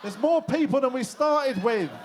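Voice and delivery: high, very loud comic outrage; hammed-up indignant yelling; exasperated shout. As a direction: shout in mock disbelief at the absurdity. Acted outrage at show's twist.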